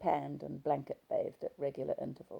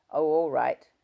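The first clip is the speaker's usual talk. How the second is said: grudging husband; 10 dB louder than usual. Acted.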